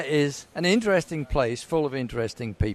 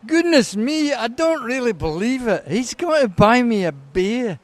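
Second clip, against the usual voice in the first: put-on high, animated Pakistani accent; hammed-up Pakistani accent, much higher; 7 semitones higher than usual. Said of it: "best Pakistani accent".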